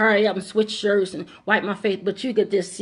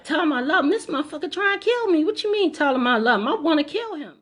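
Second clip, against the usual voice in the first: outraged; 8 semitones higher, faster than usual. Retold or imitated. Her outraged retort to the voice.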